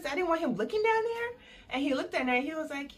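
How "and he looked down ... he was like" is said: ordinary delivery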